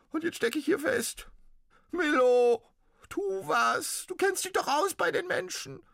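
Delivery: very high, loud squeaky ghost cry; pitiful little ghost. Acted. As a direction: wail it like a tiny trapped ghost. Ghost pleads from the oboe.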